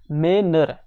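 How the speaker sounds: "मेनर" broken as मे, then नर; two separate beats in "मेनर"; split into syllables. Shown as a demonstration. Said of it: "एक तो है मेन और दूसरा है नर".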